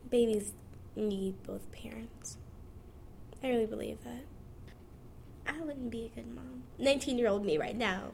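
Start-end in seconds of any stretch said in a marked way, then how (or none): 0.1-2.4 slow whispered conviction. Real, not acted. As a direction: whisper it slowly, like a truth. Serious statement of belief.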